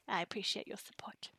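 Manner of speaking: soft unvoiced whisper; hushed sincere gratitude; whispered thanks. Real, not acted. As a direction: murmur thanks close to the mic.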